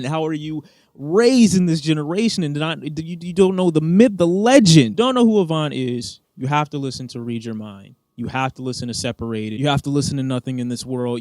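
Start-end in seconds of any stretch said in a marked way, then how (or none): 0.0-6.2 loud, raised-pitch disbelief building to a peak; exasperated rant. Real, not acted. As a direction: rant louder and higher, scandalized. Ranting at those not knowing [name].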